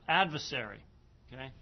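ordinary delivery

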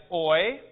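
oi said like the start of "oil"; pronunciation of omicron iota. Shown as a demonstration. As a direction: say oi as one gliding sound, as in oil. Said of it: "We're going to pronounce omicron iota as".

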